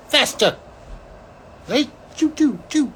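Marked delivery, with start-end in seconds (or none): 2.2-2.9 high playful train noise; train choo choo. Retold or imitated. Showing how fast you get there, high playful choo choo. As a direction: chug it out like a toy train.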